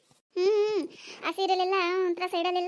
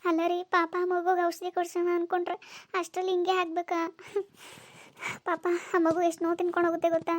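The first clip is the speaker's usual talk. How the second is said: put-on lower, sorrowful old servant voice; pitying maid; 4 semitones lower than usual. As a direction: lament for poor Lakshmi. Servant Muniyamma laments.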